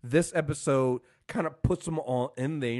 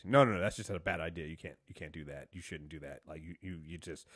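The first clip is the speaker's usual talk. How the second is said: low, very soft fumbling objection; imitating someone quietly digging himself deeper; muttered backtracking; 10 semitones lower, 9 dB quieter than usual. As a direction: trail down into an awkward, hushed refusal. Voicing Harry's backtracking to Cisco.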